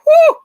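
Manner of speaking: loud, high whoop of shock; startled high exhale; recoiling whew. Retold or imitated. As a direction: blow out a sharp "whew" at the fumes. Reacting to smell of vodka.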